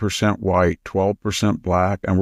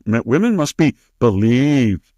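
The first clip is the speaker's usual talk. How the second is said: a put-on voice that lightly parodies the senator; mocking Senator Hirono; 3 semitones higher, 3 dB louder than usual. Host mimics Senator Hirono to mock her slogan, lightly parodic voice. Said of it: "Senator Hirono".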